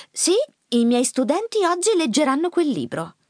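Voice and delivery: high, emphatic self-quote; defiant mother. A narrator's reading. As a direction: proudly retell standing up to the boss. Mother quotes her defiant reply. (madre che racconta con fierezza)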